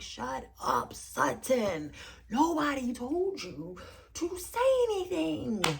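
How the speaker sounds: drawn-out scolding, climbing high at the end; theatrically exasperated outburst; exasperated telling-off. Pretend. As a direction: stretch it out, fed up with her meddling.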